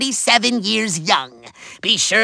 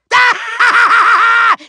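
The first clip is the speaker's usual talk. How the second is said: high, loud villain cackle; out-laugh the rival with exaggerated ha-ha-ha; showy laugh; 6 semitones higher, 4 dB louder than usual. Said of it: "I am even a better laugher".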